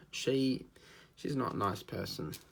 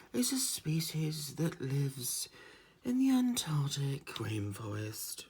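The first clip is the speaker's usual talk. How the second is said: slow whispered nature presenter, higher; whispered documentary narrator; 5 semitones higher, slower than usual. Retold or imitated. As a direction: breathe it like a wildlife film hush. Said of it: "the one there he goes".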